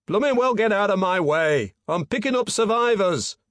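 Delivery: loud, high yell across the water; Geordie yell. A narrator's reading. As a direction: yell back indignantly over a distance. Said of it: "a Geordie voice yelled back across the water".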